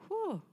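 high exhaled whew, falling steeply; heavy whew. Retold or imitated. Reacting to being told identity is in ministry, high falling whew. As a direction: let out a high, sliding whew. Reacting to the hard word received.

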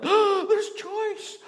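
very high, loud laughing disbelief; high laughing amazement at burger choice; amazed squeal. Retold or imitated. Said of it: "I'm like, gasps".

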